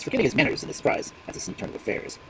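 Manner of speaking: ordinary delivery, nothing marked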